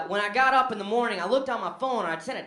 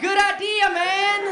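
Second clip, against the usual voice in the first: very high, loud laughing yell; wildly enthusiastic; shouted agreement; 13 semitones higher, 7 dB louder, slower than usual. Retold or imitated. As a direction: roar approval like a drunk fan.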